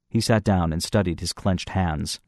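ordinary delivery, nothing marked

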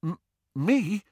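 high, halting disbelief; stammering. A narrator's reading. Young man unexpectedly named godfather, stammering. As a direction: stumble on the first sound in surprise. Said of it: "stammered Harry".

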